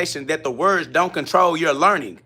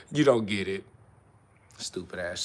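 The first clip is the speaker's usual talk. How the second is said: low muttered mockery; 10 semitones lower, 10 dB quieter than usual. Mocks viewer, then insults.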